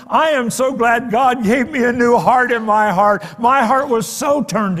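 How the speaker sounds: loud, high preaching build; full-force preaching. Acted. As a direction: preach it out to the congregation.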